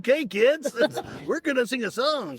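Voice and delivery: high, hyper clown voice; energetic fake clown voice. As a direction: bounce it like a kids' party clown. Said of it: "can I do my energetic fake clown voice".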